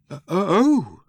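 high, hesitant dawning oh; sudden realization. A narrator's reading. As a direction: let it dawn on you, pitch rising well up. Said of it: "with sudden realization".

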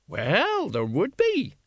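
high, hesitant woman's voice; high-pitched, unsure agreement; uncertain. A narrator's reading. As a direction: agree without being sure. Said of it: "said the woman uncertainly".

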